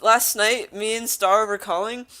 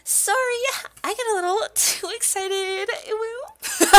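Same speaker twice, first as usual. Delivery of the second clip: very high-pitched sheepish apology; high cartoonish excitable girl; excitable game girl; 14 semitones higher, 4 dB louder, slower than usual. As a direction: squeal it in a high character voice. Voicing game girl's excited apology.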